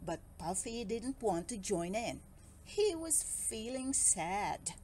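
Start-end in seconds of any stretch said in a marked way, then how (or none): none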